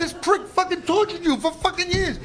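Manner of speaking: high, loud, laughing mock rage; angry yelling. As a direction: yell it out half-laughing at the outrage. Retold angry outburst at Francois.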